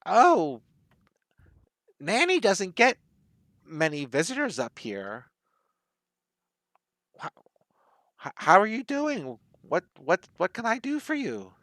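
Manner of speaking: put-on high, halting old granny voice; old woman. Old hermit woman greets rare visitors, in a high granny voice. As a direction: welcome visitors as a frail old Nanny, pitch swooping up. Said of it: "you walk up to her and she says".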